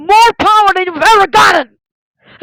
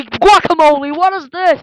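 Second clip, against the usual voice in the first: ordinary talk, nothing marked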